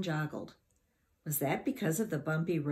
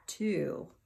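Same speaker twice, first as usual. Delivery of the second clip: ordinary delivery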